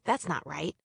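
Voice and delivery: ordinary delivery, nothing marked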